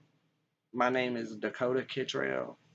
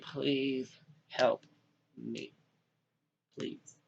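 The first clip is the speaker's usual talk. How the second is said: slow plea that drops to a hush; fading plea; 6 dB quieter, much slower than usual. Real, not acted. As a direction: let the last please barely come out. Begging for help.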